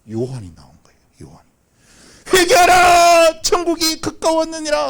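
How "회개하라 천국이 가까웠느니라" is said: very high, drawn-out loud cry; full-force preaching shout, first word stretched long; prophet's shout. Acted. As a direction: bellow a proclamation to the crowds. Voices John preaching to crowds.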